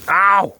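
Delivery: sharp high yelp of pain; burnt fingertips. Real, not acted. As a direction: yelp as you get burned. Burned while moving the onion.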